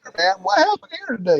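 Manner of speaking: very high, fast nerdy reporter; Nick Raleigh voice. Acted. Interviewing people at a scene, very high fast nerdy voice. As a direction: rattle it off like an eager reporter. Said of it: "as Nick Raleigh".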